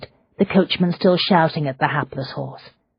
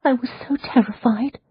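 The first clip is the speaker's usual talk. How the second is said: terrified whisper; 9 semitones higher than usual. Acted. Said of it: "she whispered again".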